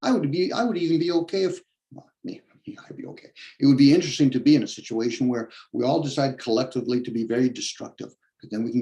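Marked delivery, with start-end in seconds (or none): 1.9-3.3 a hushed private admission; soft whispered self-correction; whispered aside. Real, not acted. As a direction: whisper it to yourself. Muttered aside to himself.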